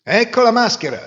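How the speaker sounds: high, loud cry of triumph; loud proud call; triumphant shout. A narrator's reading. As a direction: announce the catch with a shout. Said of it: "gridò Masino".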